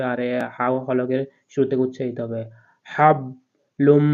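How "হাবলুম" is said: হাবলুম very slowly, হ breathed from the middle of the throat, louder. Shown as a demonstration. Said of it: "হলকের মধ্যখান থেকে উচ্চারিত হবে". (হলকের মধ্যখান থেকে হাবলুম)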